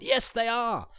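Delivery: sudden high, loud burst of recognition; eureka moment. Retold or imitated. Podcaster reliving spotting the same actor, excited. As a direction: blurt it out as the penny drops. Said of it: "a eureka moment".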